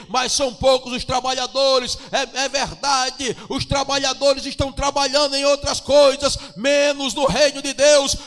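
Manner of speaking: loud, high fervent preaching; preaching at full force. Acted. Pastor rousing the congregation to evangelize, shouting with fervor. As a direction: shout the call to work like a revival preacher.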